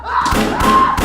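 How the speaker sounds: frantic high-pitched yelps; panicked shriek. Real, not acted. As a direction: squeal in sudden panic. Panicked as it goes off.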